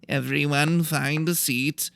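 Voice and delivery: high, slow, nasal lecturer voice; a fussy little teacher calling the class to order; nasal professor. A narrator's reading. Professor calling the class to sit down, nasal and slow. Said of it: "He talked in a nasally voice".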